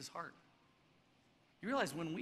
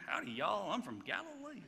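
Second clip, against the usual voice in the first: put-on southern accent; 2 semitones higher than usual. Preacher guessing at a Galilean accent, mock southern accent. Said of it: "Is it like the southern accent?".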